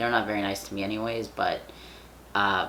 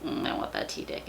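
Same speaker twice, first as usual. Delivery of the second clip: hushed, low, breathy whisper of desire; whispered want; 13 semitones lower, 4 dB quieter than usual. Retold or imitated. Speaker whispers her teasing desire to the camera, low and hushed. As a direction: breathe it like a half-joking craving. Joking about wanting his body.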